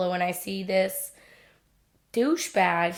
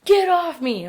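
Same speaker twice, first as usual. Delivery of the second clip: high, loud imitated bark; mocking the boyfriend's yell from ten feet away; angry yell; 8 semitones higher, 6 dB louder than usual. Boyfriend still yelling.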